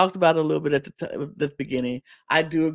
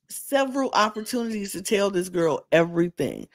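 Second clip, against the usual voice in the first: ordinary talk, nothing marked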